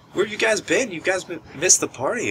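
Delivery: high, sing-song taunting voice; mock tease of latecomers; teasing girlfriends. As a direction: girlfriends ribbing the guys who missed the party. Girlfriends teasing the returning crew.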